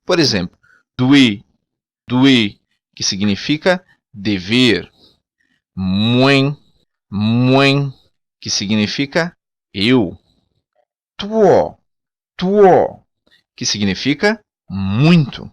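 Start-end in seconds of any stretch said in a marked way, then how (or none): 1.0-2.6 doer said "doo-EH"; the w in doer sounds as "oo"; doer, "oo" then a stressed "eh"; Creole word. Shown as a demonstration. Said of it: "o W tem som de U". 5.8-7.9 muen with an "oo" glide into a nasal end; muen, "oo" then a nasal "en"; Creole word. Shown as a demonstration. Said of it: "o W tem som de U". 11.2-13.0 tuo with "oo" and the stress at the end; tuo, "oo" then a stressed "oh"; Creole word. Shown as a demonstration. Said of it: "o W tem som de U".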